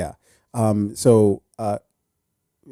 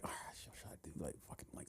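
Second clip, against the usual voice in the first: whispered thinking; 9 semitones lower, 34 dB quieter, much faster than usual. Retold or imitated. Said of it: "And I'm like".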